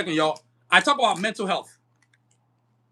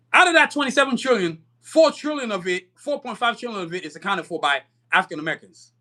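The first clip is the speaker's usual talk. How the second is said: ordinary delivery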